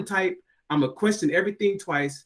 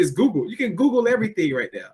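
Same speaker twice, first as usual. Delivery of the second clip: ordinary talk, nothing marked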